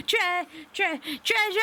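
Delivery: very high, stuttering build to a cry; stammer, then burst out with it; excited squeal. Real, not acted. Player too excited over treasure to finish, squealing. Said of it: "I got so excited".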